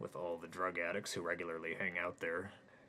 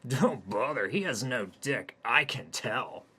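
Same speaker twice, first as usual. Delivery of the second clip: higher, louder scoffing woman; dismissive woman; 5 semitones higher, 9 dB louder, slower than usual. Acted. Orgy woman dismisses Hayden, louder and higher. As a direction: brush him off with a sneer. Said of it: "said one of the others".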